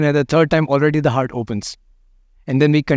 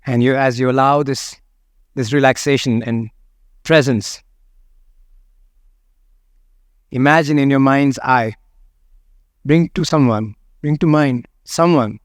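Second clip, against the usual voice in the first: guided meditation; 3 semitones lower than usual. Acted. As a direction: let each phrase settle before the next. Guides a body scan.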